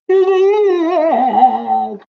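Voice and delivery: high loud laugh breaking out; laughing hard at the story; burst of laughter. Real, not acted.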